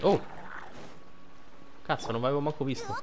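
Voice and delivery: ordinary talk, nothing marked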